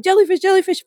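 high frantic squeal, hammed up; mock panic. Swimmer scrambling back onto the boat, mock-panicked squeal. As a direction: imitate someone scrabbling in panic. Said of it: "I'm scrabbling".

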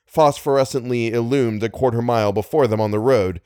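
ordinary delivery, nothing marked

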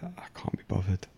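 drained, barely-there whisper of giving up. Retold or imitated. Waking up depressed, to himself, a weary whisper. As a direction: breathe it out like you can't face the day.

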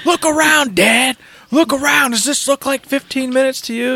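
put-on high-pitched upset boy; Atreus, angry. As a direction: imitate a son indignantly yelling up at his dad. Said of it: "He's angry".

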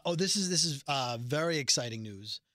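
normal talking voice

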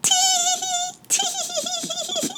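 very high squeaky tee-hee; tiny tittering pixies; pixie giggle. Acted. Pixies mocking the wet fairies, squeaky. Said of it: "giggled the pixies".

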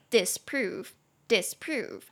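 disprove, dis light and prove stressed, said slowly twice; stress on second syllable. Shown as a demonstration. Teacher modelling disprove slowly, stress on prove. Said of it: "重音节在第二，disprove".

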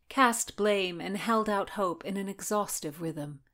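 plain reading voice

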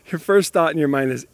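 normal talking voice

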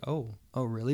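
a hushed, taken-aback reply; quiet aside. Retold or imitated. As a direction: say it quietly, just to him. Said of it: "this is quietly to me".

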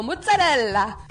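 loud, sing-song Italian-style mozzarella; exaggerated Italian mozzarella. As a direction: belt out mozzarella like an Italian cook. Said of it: "I also used to go".

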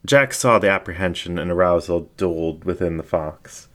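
ordinary delivery

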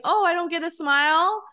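high-pitched needy guilt-trip; mock pouting. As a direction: fish for a smile with a put-on pout. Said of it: "like, to say out loud".

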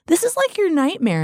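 high-pitched retold exclamation; mock alarm. Retelling her exclamation to husband.

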